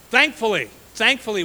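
rejoicing preacher shout; exultant. Real, not acted. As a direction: proclaim it like good news. Sermon turns to grace.

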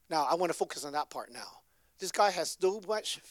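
ordinary talk, nothing marked

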